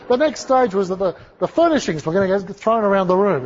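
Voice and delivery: ordinary delivery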